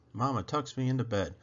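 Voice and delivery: usual speaking voice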